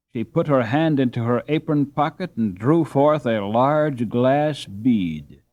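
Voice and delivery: ordinary delivery, nothing marked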